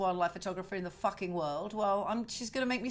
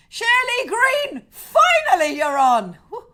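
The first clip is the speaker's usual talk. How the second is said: high, loud and slow, close to a happy shriek; a delighted cry of relief that she made it; 12 semitones higher, 12 dB louder than usual. Real, not acted. Greeting a friend who finally made it on, delighted and loud.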